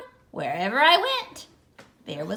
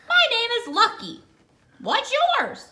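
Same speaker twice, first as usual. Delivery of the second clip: high quick friendly dog; bright high dog intro; cheerful dog; 9 semitones higher, 3 dB louder than usual. Acted. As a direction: chirp it out high and fast.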